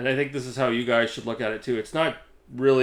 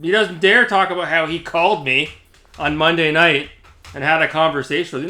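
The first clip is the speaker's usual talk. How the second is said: ordinary talk, nothing marked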